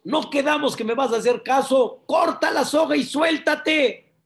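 high, loud shouted reproach; exasperated command. Acted. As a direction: shout the order at someone breaking a promise. God scolds, repeats the order. (reproche a gritos)

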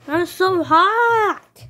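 high, loud and slow whine; overheated queen. Pretend. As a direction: moan about the heat dramatically.

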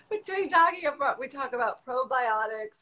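amused giggling; laughing. Real, not acted. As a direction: speak through a laugh. Still laughing.

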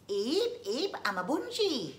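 high, loud little creature squeak; eager squeaky bungee voice. Acted. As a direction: chirp it out like an excited tiny beast.

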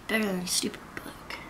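teasing whisper; whispered jab at the book. Real, not acted. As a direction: hush it like a playful secret.

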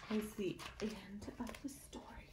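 soft unvoiced whisper. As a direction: hush the story to its end. Closing the story softly.

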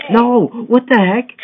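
very high, very loud cry of disbelief; real frustrated scream. Real, not acted. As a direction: scream it like the game robbed you. Falls again in parkour game.

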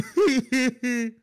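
high little run of giggles; nervous tittering laugh. Real, not acted. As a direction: giggle away from your hiding spot. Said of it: "why are you laughing? Are you nervous".

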